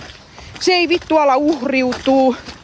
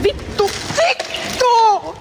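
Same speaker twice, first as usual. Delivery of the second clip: frustrated outburst; 10 semitones higher, much slower than usual. Real, not acted. Raging at woman threatening her.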